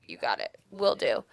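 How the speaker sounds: low, lazy mock agreement; deep sarcastic "sure thing" queen; mock compliant queen. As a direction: drop the pitch way down and brush it off. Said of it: "The queen was like".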